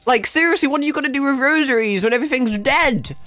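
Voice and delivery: real outrage, voice up high; outraged rant. Real, not acted. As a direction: rant at the greedy shopkeeper. Ranting at greedy NPC.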